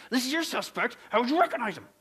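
lightly voiced testy policeman. Policeman testing the third and final trainee, mildly testy. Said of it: "he says in a real testy voice".

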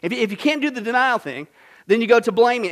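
usual speaking voice

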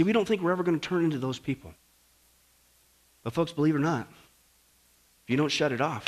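ordinary delivery

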